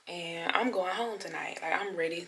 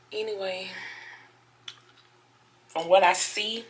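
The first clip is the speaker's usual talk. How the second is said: ordinary delivery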